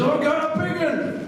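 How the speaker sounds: chirpy mimicry of a mate calling; imitating Davey. Voicing Davey.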